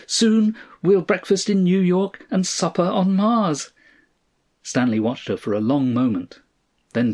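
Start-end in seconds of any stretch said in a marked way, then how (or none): 0.1-3.7 high, glowing enthusiasm; excited old woman. A narrator's reading. Mother-in-law imagines supper on Mars, thrilled. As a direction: gush it high, carried away by the dream. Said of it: "eyes glowed like little bulbs".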